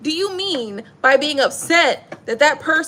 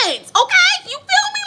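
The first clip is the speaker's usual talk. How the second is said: much higher and louder; excited; 3 dB louder than usual. Real, not acted. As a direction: pop up excitedly. Excited aside.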